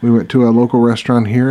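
ordinary talk

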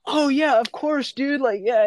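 high, bright-sounding sudden friendliness; imitating the couple relaxing into friendliness; suddenly friendly couple. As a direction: switch to a chummy, relieved welcome. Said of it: "their accents changed".

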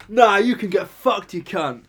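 loud, slow, high insult dropping at the end; mock belligerence; imitated angry customer. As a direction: act out a furious dismissal. Retold abuse from angry customer.

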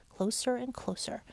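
usual speaking voice

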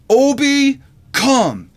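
put-on high, loud little-kid command; imitated three-year-old. Three-year-old orders the big dog over, imitated high and loud. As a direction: mimic a small child calling the dog, pitched right up. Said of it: "my little three-year-old looks at my dog".

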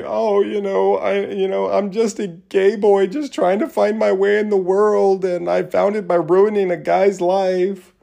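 high, sing-song mocking voice; put-on high-pitched plea, dripping with mockery; mock self-pitying excuse. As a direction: imitate a whiny excuse in a high voice. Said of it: "tried to say".

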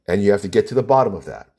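ordinary talk

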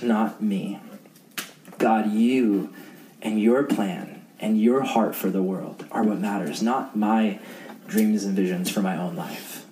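low, earnest prayer voice. Acted. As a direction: pray humbly before the group. Leads closing prayer.

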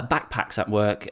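normal talking voice

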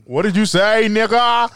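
high, loud mock indignation; hammed-up parental outrage; outraged parent. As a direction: rise to a drawn-out incredulous yell.

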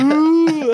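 high mocking ooh sound. Filming the hecklers, a high mocking ooh. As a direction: coo it out high to mock them.

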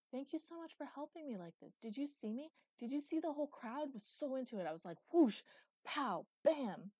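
high, loud, bursting with enthusiasm; excited gushing. A narrator's reading. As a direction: relive the show with booming sound effects. Boy re-enacts his show, gesturing happily.

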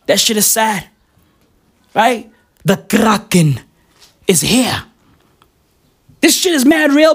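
ordinary talk

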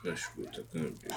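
drowsy half-whispered radio reply; sleepy low mumble. Acted. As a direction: mumble it low, barely awake. Sheriff answers radio sleepily.

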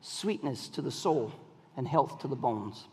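low, soft, slow scripture reading. Acted. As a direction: read it softly, like honey. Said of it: "Proverbs sixteen twenty-four".

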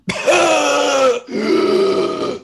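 huge, loud, high gasp; long dramatic gasping breath, acted out; imitated revival gasp. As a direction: suck in air as if jolted back to life. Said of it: "She went".

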